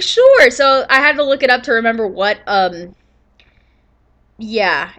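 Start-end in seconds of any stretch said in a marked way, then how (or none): 0.0-0.5 high, loud squeaky sure; mock sure. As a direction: chirp it like an eager kid. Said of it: "So I was like".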